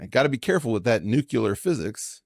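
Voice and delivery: "nuclear" said as nucular; folksy mispronunciation. Pretend. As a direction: play folksy, saying "nuclear" as NOO-kyuh-ler. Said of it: "get Democrats to correct me".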